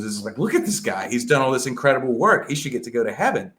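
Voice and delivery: Satan's rant, lightly played and rising to outrage; mock Satan pleading. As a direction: argue like an indignant devil. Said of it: "Satan looks at that and goes".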